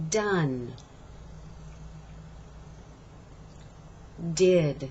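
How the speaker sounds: "Do", "Day", "Done", "Did" with a voiced d and no puff; slow model words, voice on each d. Shown as a demonstration. Teacher modeling d words for students to repeat. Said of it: "Let's go on to the D words".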